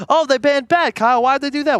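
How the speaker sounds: a hammed-up eager viewer asking; mock eager viewer. Analyst mocking viewers who ask during bans, hammed-up. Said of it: "mock viewer question".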